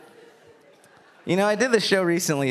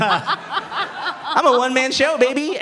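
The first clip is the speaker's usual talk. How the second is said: cocky showman; 8 semitones higher, slower than usual. Pretend. Boastful showman flourish.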